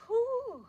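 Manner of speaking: high, breathy whew of relief; relieved whew. Real, not acted. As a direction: blow out a tired whew. Exhale after intense pose.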